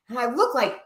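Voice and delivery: normal talking voice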